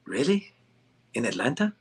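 imitating doubtful Hawaiian friends, lightly accented; bad Hawaiian accent. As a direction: put on a rough Hawaiian accent for a questioning friend. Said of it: "Sorry for my bad, um, Hawaiian, uh, accent".

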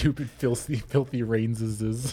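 low, slow, soft Gollum spite. Retold or imitated. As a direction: spit it like bitter Gollum. Continues the Gollum impression.

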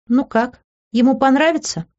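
high, coy question; feigned shyness. A narrator's reading. Woman showing off her outfit, feigned shyness. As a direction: ask coyly, pretending to be bashful. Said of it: "наигранно смутившись".

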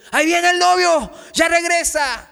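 high, loud cry of the news; excited shout. Acted. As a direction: call out that the groom is coming. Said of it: "escucharon los gritos".

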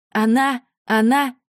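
high loud panicked stammer. A narrator's reading. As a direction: stutter it out in panic. Heroine panics at the girl.

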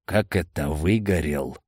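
low, mildly bewildered question. A narrator's reading. Amadei asks how the man burned, bewildered. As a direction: drop low, at a loss. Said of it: "растерянно переспросил Амадей".

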